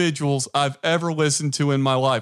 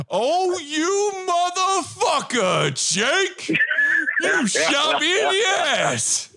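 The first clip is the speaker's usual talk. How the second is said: slow, much higher, crying Denzel voice; Denzel impersonation; 11 semitones higher, much slower than usual. Retold or imitated. Brother's Denzel impression, shot and wailing, slow and high. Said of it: "my brother does the best Denzel impersonation".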